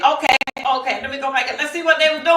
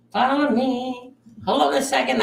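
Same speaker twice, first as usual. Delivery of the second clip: ordinary talk, nothing marked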